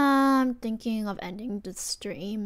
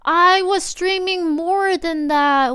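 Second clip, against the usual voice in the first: much louder and higher, slowly stretched; loud complaint; 7 semitones higher, 12 dB louder, much slower than usual. Real, not acted. Streamer annoyed at stream hours, loud slow whine. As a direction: protest loudly at the timer.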